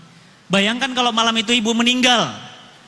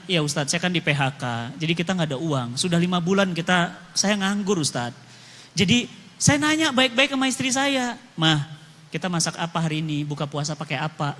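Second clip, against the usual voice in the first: low and soft, a broken man recounting; quiet weary telling of his hardship; lightly voiced downcast prisoner; subdued prisoner; 7 semitones lower, 4 dB quieter than usual. Prisoner tells his story.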